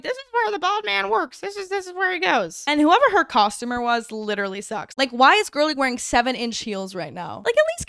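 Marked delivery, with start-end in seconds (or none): 0.0-2.6 a light sing-song Pepper voice, higher; skipping Pepper. Retold or imitated. Pepper skipping with her agents, sing-song higher. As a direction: bounce through it lightly, higher. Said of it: "she's skipping through, like".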